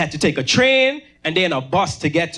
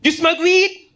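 gang guy asking; 9 semitones higher than usual. Retold or imitated. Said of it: "The boy says".

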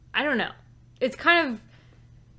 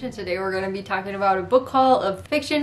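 usual speaking voice

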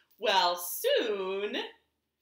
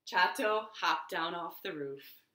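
plain reading voice

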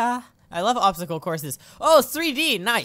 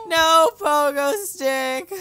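mock anguish; 7 semitones higher, 5 dB louder, much slower than usual. Mourning his game character.

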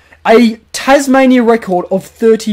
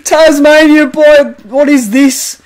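excited goal call; 5 semitones higher than usual. Acted. Commentating his own goal for viewers, thrilled. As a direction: sell the goal like a broadcaster.

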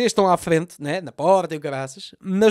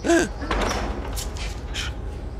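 shocked gasp; 10 semitones higher, 4 dB quieter than usual. Pretend. Olivia sees the bodies.

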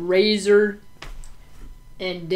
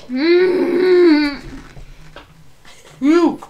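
loud pained groan; burned mouth; 10 semitones higher, 9 dB louder than usual. Real, not acted. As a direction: groan through the burning. Chip is burning his mouth.

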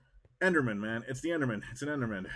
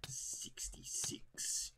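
low, slow raspy whispered command; Palpatine whisper; 10 semitones lower, 13 dB quieter, slower than usual. Acted. Gamer playing Palpatine giving the order, whispered low. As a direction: hiss the order like a dark lord. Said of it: "You sound like Palpy".

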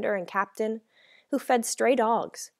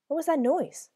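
demanding, higher-pitched question; 7 semitones higher than usual. A narrator's reading. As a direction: demand an answer from them. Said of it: "the tall man demanded".

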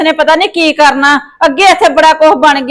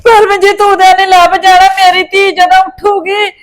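distraught, raised wailing lament; tearful wailing; 3 semitones higher than usual. Acted. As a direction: wail in panic over the missing child. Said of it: "mummy, ਤੁਸੀਂ ਕਿਉਂ ਰੋਂਦੇ ਹੋ?". (ਰੋ-ਰੋ ਕੇ ਵਿਰਲਾਪ)

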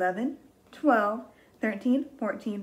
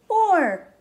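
excited; 4 semitones higher, 6 dB louder, much slower than usual. Shown as a demonstration. Counting climax, excited.

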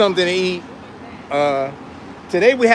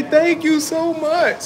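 higher, slow, beaming thanks; delighted thanks to a surprise subscriber; gushing thanks; 9 semitones higher, slower than usual. Real, not acted. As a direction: gush over the fan.

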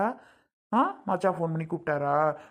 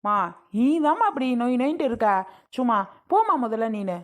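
higher-pitched, fed-up comeback; peevish, raised-pitch arguing; irritated retort; 4 semitones higher than usual. Acted. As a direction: shoo a nagging mother away crossly. Daughter snapping at nagging mother.

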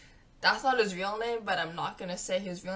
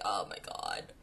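whispered oh my god; 6 dB quieter than usual. Retold or imitated. Caught by friend's look.

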